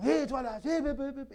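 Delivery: loud mock quarrel; mock angry outburst. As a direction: imitate a displeased person calling someone out. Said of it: "quand ils ne sont pas contents".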